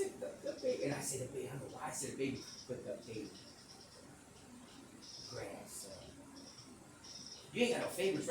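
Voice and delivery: usual speaking voice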